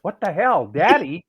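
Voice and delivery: high, questioning mock disbelief; incredulous echo. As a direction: replay a stunned double take. His stunned thought on hearing Daddy.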